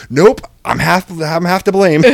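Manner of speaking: mock-panicked teen owning half the blame; imitating the nervous teen. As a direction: blurt it like a kid scrambling to take the blame. Teen quickly backpedals, voiced.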